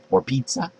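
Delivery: just breathe it out; under your breath. Shown as a demonstration. Parent murmuring a treat word under the breath. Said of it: "under your breath say".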